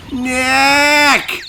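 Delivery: one long high-pitched holler; loud yell. Acted. Nick yelling his name at the camera, loud. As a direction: stretch the name out at full shout. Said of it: "Maybe I'm not saying it loud enough".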